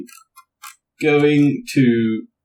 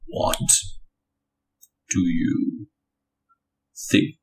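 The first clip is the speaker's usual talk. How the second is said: low, hushed, word-by-word reply; soft and deep with long pauses; Ulfric Stormcloak; 6 semitones lower, 7 dB quieter, slower than usual. Acted. Character with hands on hips answering a rival, Ulfric Stormcloak voice. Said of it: "Thousand Sons talk like Ulfric Stormcloak".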